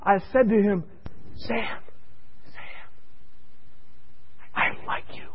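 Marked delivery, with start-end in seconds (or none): 1.4-5.3 breath-only, gentle whisper. Retold or imitated. Nurse telling the patient she likes him, whisper. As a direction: whisper it slowly, with pauses. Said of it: "me whispering".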